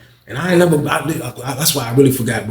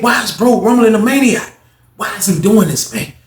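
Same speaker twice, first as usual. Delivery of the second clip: puzzled thought; 8 semitones higher than usual. Retold or imitated. Inner thought.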